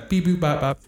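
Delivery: playful robot beeping noises; silly computer sound effect; robot beeps. Pretend. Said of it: "That was dumb".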